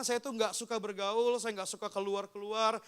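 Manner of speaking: usual speaking voice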